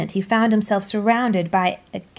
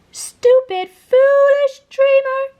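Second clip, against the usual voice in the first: high, loud, slow sneering taunt; mock the boy cruelly; evil aunts jeering in his head; 8 dB louder, slower than usual. A narrator's reading. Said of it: "rang the voices in his head".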